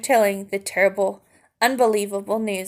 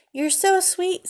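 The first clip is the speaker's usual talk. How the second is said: sweet princess coo; 9 semitones higher than usual. Acted. Princess coos at tiny mouse.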